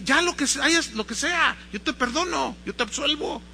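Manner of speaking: high, impatient absolution; acted fed-up confessor; exasperated priest. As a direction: wave the sin away to get it over with. Weary priest absolving child in haste.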